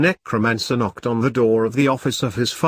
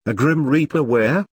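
horrified; 3 semitones higher than usual. A narrator's reading. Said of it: "asked the ghost in horror".

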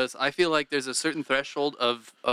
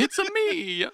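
high, squeaky mock Italian; quick cartoon plumber voice; Mario impression; much slower than usual. Cohost jumping in with a Mario voice, mock Italian. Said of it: "No, no, I'm not doing it".

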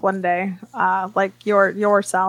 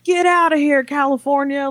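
imitating a heckler, high and loud; mock yell, pitched well up; heckler's shout; 6 semitones higher, 4 dB louder than usual. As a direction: holler it like a hostile heckler. Retelling a heckler's hostile shout.

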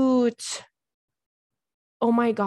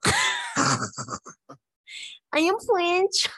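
very high, hammed-up French accent; exaggerated French accent; 10 semitones higher, much slower than usual. Host repeating her French gag, exaggerated French accent. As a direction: push the fake French accent sky-high.

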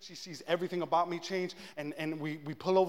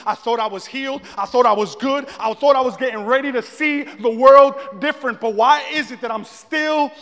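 rising higher and louder to a cry; building frustrated plea; 7 semitones higher, 13 dB louder than usual. Retold or imitated. Man questions God after relapsing, mounting loud frustration. Retold anguished complaint to God.

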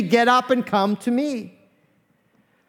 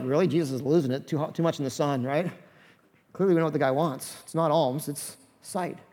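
lower, much softer grumbling aside; muttered aside; 5 semitones lower, 6 dB quieter than usual. Retold or imitated. Disciples grumbling to each other, softer muttered aside. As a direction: mutter it behind his back.

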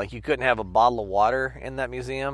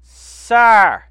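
loud, higher repeated call across the room; calling out sir; 8 semitones higher, 14 dB louder, much slower than usual. Retold or imitated. Museum guard calling a visitor, loud higher call. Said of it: "hear someone going".

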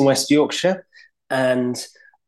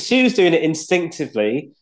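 ordinary talk, nothing marked

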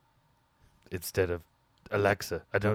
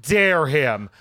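outraged gossip; 9 semitones higher, 11 dB louder, much slower than usual. Acted.

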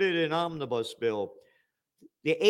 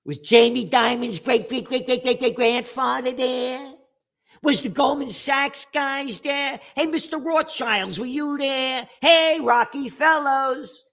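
mocking shout; 7 semitones higher than usual. Real, not acted. Ranting host taunts bankers at the temple, mocking shout. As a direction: call them out like a heckler.

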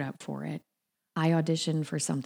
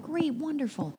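bright acceptance; 11 semitones higher than usual. Retold or imitated. Acted phone reply to producer.

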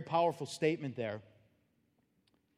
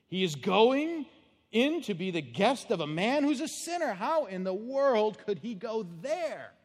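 1 grumbling crowd; 5 semitones higher, 5 dB louder, slower than usual. Retold or imitated. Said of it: "they all grumbled".

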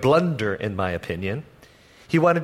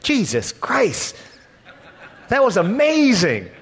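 amazed exclamation; 7 semitones higher than usual. Retold or imitated. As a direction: blurt it out, awestruck and laughing. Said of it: "they would almost have sworn by saying".

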